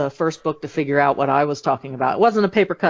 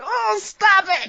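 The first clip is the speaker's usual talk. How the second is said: much higher and slow, mock squeal of disgust; squeamish audience; much slower than usual. Listeners recoiling at the gory detail, high slow mock squeal. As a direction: pull back in dainty horror. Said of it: "Half of your audience is now going".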